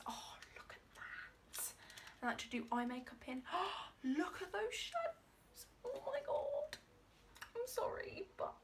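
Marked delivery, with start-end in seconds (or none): 0.1-1.7 whispered in wonder, slowing down; hushed awe over pretty packaging; awed whisper. Real, not acted. Admiring packaging up close. 3.5-8.5 much higher, half-whispered, slow; high breathless wonder at shadows; breathless awe. Real, not acted. Gasping at eyeshadow shades.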